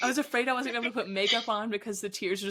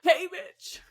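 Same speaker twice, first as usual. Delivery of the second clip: sassy greeting; 9 semitones higher, 4 dB louder, much slower than usual. Retold or imitated. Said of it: "I was like".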